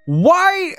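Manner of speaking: high-pitched, drawn-out disbelieving wail; exasperated outcry broken by a sigh. Real, not acted. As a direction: plead for an answer, voice way up. Exasperated outburst at the game.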